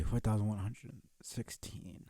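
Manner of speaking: ordinary talk, nothing marked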